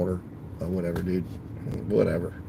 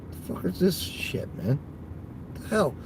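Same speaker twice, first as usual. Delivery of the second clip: exasperated whisper; 7 semitones higher, 9 dB quieter than usual. Real, not acted. Driver sees a surprise add-on order.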